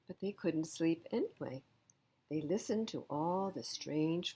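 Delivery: usual speaking voice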